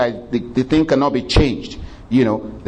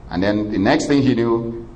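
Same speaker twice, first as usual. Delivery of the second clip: normal talking voice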